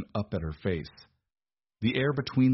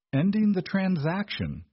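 flatly; 11 semitones higher, 4 dB louder than usual. A narrator's reading. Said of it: "Desmond said flatly".

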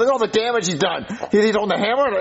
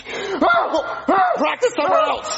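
high, slow, laughing brush-off; annoyed dismissal; 12 semitones higher, much slower than usual. Acted. Dad shooing Jimmy away from practising, laughing. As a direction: shoo him away with a strained laugh.

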